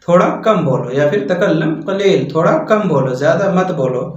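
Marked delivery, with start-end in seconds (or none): none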